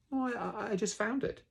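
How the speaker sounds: high, stammering bear voice; Pooh's hesitant answer. Acted. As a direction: stumble over it innocently, high. Pooh answers, taken aback.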